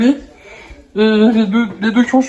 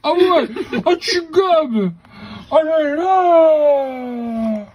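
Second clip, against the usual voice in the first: playful gushing; 6 semitones higher, much slower than usual. Real, not acted. Parent teasing the baby gobbling, playful gushing. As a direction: swoop up high and draw each exclamation out.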